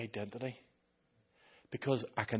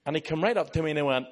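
normal talking voice

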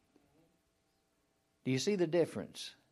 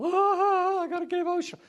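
very high, hammed-up wail; mock emotional outburst; 4 dB louder than usual. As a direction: fake a tearful breakdown at the top of the voice. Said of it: "getting emotional".

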